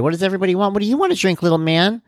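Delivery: high-pitched imitation of a chirpy server; waitress. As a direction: mimic a waitress talking down to a little kid. Imitating waitress addressing table and child.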